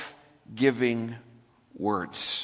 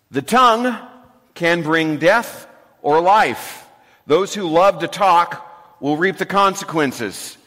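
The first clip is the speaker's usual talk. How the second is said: slow, weighty reading of the proverb, first word raised and loud; solemn scripture reading; 3 semitones higher, 4 dB louder than usual. Shown as a demonstration. Pastor reads the proverb aloud to the congregation, slow and weighty. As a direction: read the verse for the church to repeat. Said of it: "I'm gonna read it".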